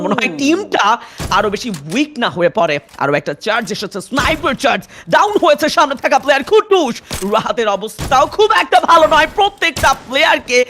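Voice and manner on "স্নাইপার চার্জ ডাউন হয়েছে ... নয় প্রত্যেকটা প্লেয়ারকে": উঁচু গলায় জোরে ধারাভাষ্য; দ্রুত ঘটনার বর্ণনা; esports caster. Acted. Live play-by-play of a fight. (উত্তেজিত ই-স্পোর্টস কাস্টারের কণ্ঠ)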